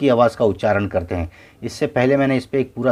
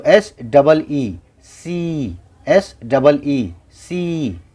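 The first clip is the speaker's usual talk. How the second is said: slow, stretched spelling of each letter; drawn-out spelling; much slower than usual. Shown as a demonstration. Teacher stretching the spelling for students, slow and drawn out. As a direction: stretch every letter out for the class. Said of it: "कितना लंबा खींचा".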